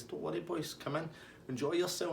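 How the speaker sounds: high, soft, welcoming imitation; chilled-out host. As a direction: wave off trouble like an unbothered host. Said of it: "He's just chilling out".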